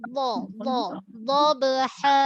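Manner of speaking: "Dha" repeated, heavy and full; dhad drill. Shown as a demonstration. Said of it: "Makhraj dhad".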